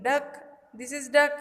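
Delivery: ordinary talk, nothing marked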